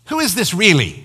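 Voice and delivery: high, loud disbelieving question; incredulous mother. Acted. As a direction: play a mother demanding the caller's identity. Acting out the caller's incredulous mother.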